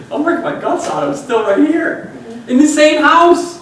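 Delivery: high, loud, laughing disbelief; man laughing at how his retirement plan failed; laughing at himself. Real, not acted. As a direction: laugh through it, slowing down. Laughing at his own naivety.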